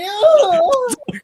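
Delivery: high shrieking laugh; loud laugh. Real, not acted. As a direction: burst out laughing. Laughing outburst.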